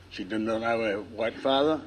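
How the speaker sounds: ordinary talk, nothing marked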